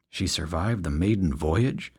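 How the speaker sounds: usual speaking voice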